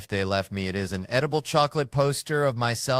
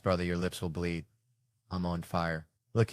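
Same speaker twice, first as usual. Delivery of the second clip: ordinary talk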